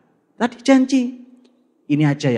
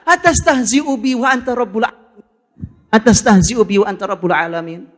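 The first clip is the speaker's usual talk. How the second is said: higher, disbelieving Arabic quote; incredulous cry; 5 semitones higher, 4 dB louder than usual. Acted. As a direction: voice the man who cannot believe it.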